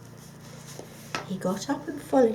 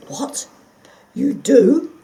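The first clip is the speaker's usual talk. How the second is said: eager, loud, higher question; 4 semitones higher, 13 dB louder, slower than usual. Acted. The dog eagerly asking the fox, loud and high. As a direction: jump at the news. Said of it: "asked the dog eagerly".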